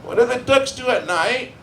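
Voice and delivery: put-on high-pitched childlike question; put-on high, naive voice; childlike stepdaughter. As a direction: imitate a grown woman with a child's mind. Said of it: "thirteen nine-year-old set into a twenty-six-year-old body".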